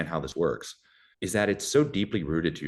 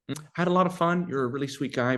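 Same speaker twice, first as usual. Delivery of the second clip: higher voice for a woman letting him down; imagined polite letdown; 8 semitones higher than usual. Pretend. Woman turning down her date, high polite letdown. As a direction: let him down nicely, pitched up.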